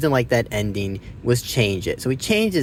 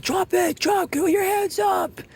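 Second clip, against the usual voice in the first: high mock shout of police commands; FBI agents yelling. Retelling the FBI raid, agents yelling commands in a mock shout. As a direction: bark it like an agent storming in. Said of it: "they're like".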